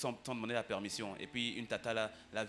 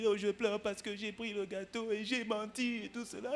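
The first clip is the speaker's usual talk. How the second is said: put-on much higher voice of a tearful little girl; mock childlike plea, pitched up; 9 semitones higher than usual. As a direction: play the sobbing daughter explaining herself. Daughter's tearful answer retold.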